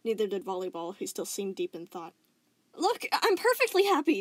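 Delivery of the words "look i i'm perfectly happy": very high, loud, stammering; forced insistence. Acted. Spinel insisting to Steven, forced cheer. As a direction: insist too hard. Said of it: "Spinell insisted".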